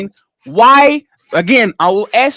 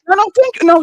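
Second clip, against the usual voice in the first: ordinary talk, nothing marked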